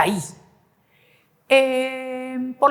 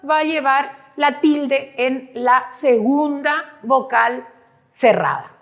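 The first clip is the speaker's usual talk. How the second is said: ordinary talk, nothing marked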